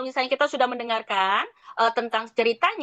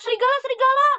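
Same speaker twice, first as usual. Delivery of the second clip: much higher, fast mock alarm; playful shout of Serigala, much higher; shouting boy; 10 semitones higher, faster than usual. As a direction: cry out to the villagers, hurried. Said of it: "ceritanya dia teriak".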